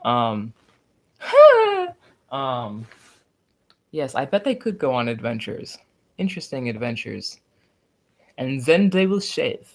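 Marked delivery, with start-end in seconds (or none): 1.2-1.9 very high, loud laughing squeal. Real, not acted. Reacting during the livestream. 8.4-9.7 put-on higher comic Russian accent. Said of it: "this hilarious, like, Russian".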